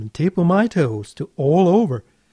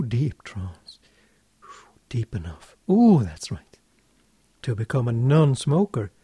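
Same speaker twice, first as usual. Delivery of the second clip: normal talking voice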